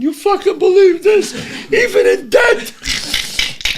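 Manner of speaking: very high, strained mock shout; incredulous outcry. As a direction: cry out in disbelief like an exasperated onlooker, stretching the end. Crowd's reaction to the flat hearse.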